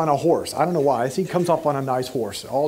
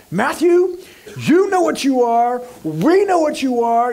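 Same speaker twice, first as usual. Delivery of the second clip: higher, louder accusing call-out; mock accusation; 7 semitones higher, 5 dB louder than usual. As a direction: point the finger at Matthew, loud and high. Imagined call-out of Matthew.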